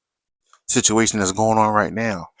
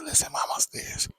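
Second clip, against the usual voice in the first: whispered warning; 11 dB quieter than usual. Retold or imitated. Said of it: "She's like".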